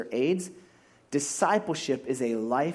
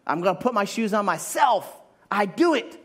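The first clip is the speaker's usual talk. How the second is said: put-on higher, louder toddler-like insistence; defiant child; 5 semitones higher, 4 dB louder than usual. Believer refusing Jesus's help like a toddler, higher and louder. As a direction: play a stubborn child talking to God. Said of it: "here we are in life saying".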